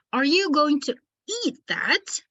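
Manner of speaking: pause, then emphasize "eat"; stress on EAT. Shown as a demonstration. Teacher doubts it is food, stress on "eat". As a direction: hold off, then lean on "eat". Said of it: "I'm not sure it's really food".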